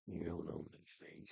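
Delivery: quiet, low menacing repeat. Pretend. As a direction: drop it to a low murmur. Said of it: "you're the pig".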